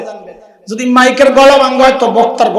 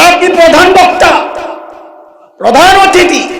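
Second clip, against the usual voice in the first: exclaimed title; 7 semitones higher, 3 dB louder than usual. Acted. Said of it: "বলে".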